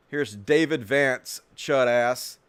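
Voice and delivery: usual speaking voice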